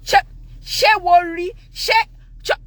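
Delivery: imitating an angry man's stutter; high, loud, stuck on broken syllables; upset stutter. Dad yelling at the gateman, stuttering with rage. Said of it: "he had a stutter when he got upset".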